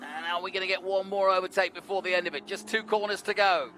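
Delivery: measured live race play-by-play; motorsport commentator. Real, not acted. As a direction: call the positions over the track.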